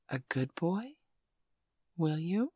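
ordinary talk

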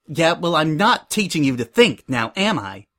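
scoff, then drop on the closing question. Acted. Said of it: "Suri scoffed".

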